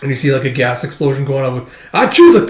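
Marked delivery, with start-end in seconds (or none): 1.9-2.3 high, sharp sneeze; loud sneeze. Retold or imitated. Gas makes them sneeze.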